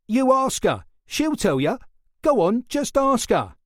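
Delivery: high, loud and daring; defiant challenge. A narrator's reading. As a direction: dare him to ask her. Suspect challenging detective.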